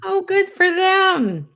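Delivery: high, drawn-out mock congratulations; "good for them" in an exaggerated high sing-out; sarcastic. Real, not acted. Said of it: "I'm being sarcastic about them".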